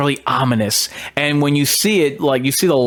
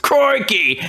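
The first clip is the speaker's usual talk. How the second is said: high, hammed-up Aussie exclamation; Aussie accent; 8 semitones higher than usual. Reviewer showing off his Aussie accent, hammed-up Australian. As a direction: exclaim it in a put-on Australian accent, pitch falling. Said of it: "That's a pretty good Aussie accent".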